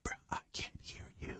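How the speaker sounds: mock whisper, barely audible; super quiet. Coach mimicking quiet students back at them, mock whispering. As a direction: answer in a voiceless whisper. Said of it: "like, super quiet".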